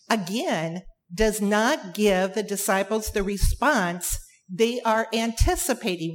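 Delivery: ordinary talk, nothing marked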